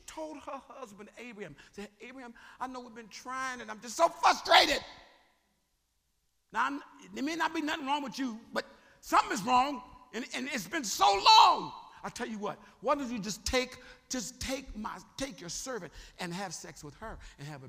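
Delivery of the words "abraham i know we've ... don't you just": loud, higher, frustrated plea; pleading wife. Acted. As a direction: beg your husband, loud and desperate. Sarah pleads with her husband.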